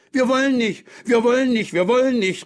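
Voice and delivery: put-on high, insistent childish refusal; children calling out. A narrator's reading. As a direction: chant it like stubborn kids. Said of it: "riefen die Kinder einstimmig aus". (trotzig rufende, hohe Kinderstimme)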